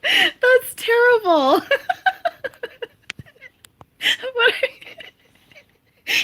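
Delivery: high, slow, wheezing with laughter; can barely speak for laughing. Real, not acted. Cracking up at a joke.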